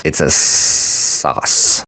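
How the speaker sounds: slow breathy whisper of the line. Pretend. Muttering a pun to the listeners, slow whisper. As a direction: hush it out slowly, almost no voice. Wordplay on possum sauce.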